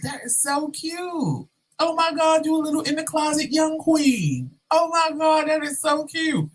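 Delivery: very high, campy squeal. Acted. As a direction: gush in a teasing high sing-song. Coworker gushes over closeted queen.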